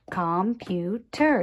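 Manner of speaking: "computer" in three even, separated beats; tapped syllables. Shown as a demonstration. Teacher tapping along with children, "computer" split into syllables. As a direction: tap out "computer" as com, pu, ter. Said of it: "tap it".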